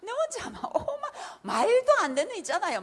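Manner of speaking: high, loud laugh-through words. Real, not acted. Speaker laughing at her own past ambition, high and loud. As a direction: laugh at how absurd you were.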